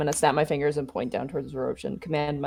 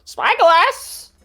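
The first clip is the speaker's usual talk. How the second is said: much higher squawking parrot; 9 dB louder than usual. Pretend. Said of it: "go ahead and speak for the parrot".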